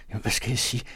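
asking helplessly, unsure how to break the news; breathless fright. A narrator's reading. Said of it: "ganske bleg og forpustet løbende". (forpustet og bange, lidt højere)

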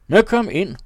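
loud, high call to come in. A narrator's reading. Captain shouting to a visitor at the door to come in. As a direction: shout it through the door, welcoming. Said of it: "råbte kaptajnen".

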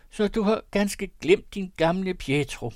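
a mild, high-pitched call of reproach. Acted. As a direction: call it to him, half hurt. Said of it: "råbte jeg".

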